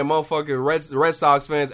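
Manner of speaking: ordinary talk, nothing marked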